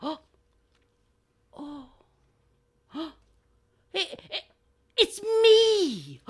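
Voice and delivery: tiny gasped ohs building to high cry; dawning realization. Acted. Last crow realizing he is finest, amazed.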